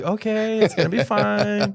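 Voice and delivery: high-pitched, forced cheer; comically overdone reassurance after an outburst; strained reassurance. Retold or imitated. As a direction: feign calm in a high sing-out voice. Returns to child feigning calm.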